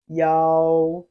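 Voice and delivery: loud, drawn-out yao for students to copy; Chinese yao. Shown as a demonstration. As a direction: model yao clearly. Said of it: "Repeat after me".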